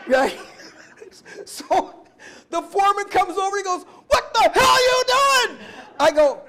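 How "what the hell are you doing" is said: loud, high furious yell; shouted foreman's rage. Retold or imitated. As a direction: bellow it at the new guy. Foreman yelling at him.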